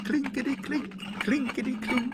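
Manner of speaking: high, staccato rattling of coins; coins clinking. A narrator's reading. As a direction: imitate coins jingling in a tin. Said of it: "when he heard".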